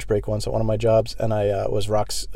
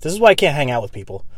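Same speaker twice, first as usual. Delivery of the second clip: usual speaking voice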